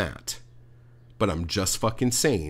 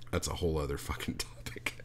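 whispered aside; 3 semitones lower, 8 dB quieter than usual. Real, not acted. Muttered aside after rant.

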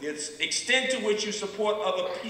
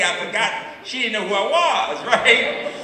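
real laugh breaking through, louder; 5 semitones higher, 9 dB louder than usual. Real, not acted. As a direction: laughs at his own mistake as he says it. Laughing at his own mix-up.

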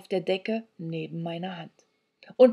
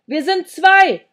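loud, high, slow shout; drawn-out yell; bellowed; 10 semitones higher, 12 dB louder, slower than usual. A narrator's reading. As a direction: bellow it slowly for a deaf listener. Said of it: "brüllte ich".

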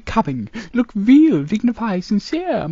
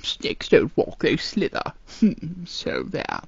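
hissing whisper; 8 semitones lower, 7 dB quieter than usual. Acted. Snake sulking in hushed voice.

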